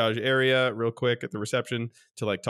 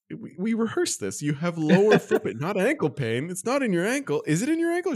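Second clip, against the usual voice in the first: exasperated scolding; 7 semitones higher than usual. Retold or imitated. Scolding mother-in-law.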